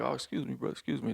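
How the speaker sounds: soft, mild excuse me to a stranger; quiet easygoing apology; polite apology. Retold or imitated. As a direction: apologize lightly, no trouble wanted. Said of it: "you're like".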